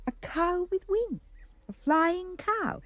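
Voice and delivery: slow, high-pitched amazement; wonder. A narrator's reading. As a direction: marvel at it, drawn out and high. Narrator marvels at winged cow.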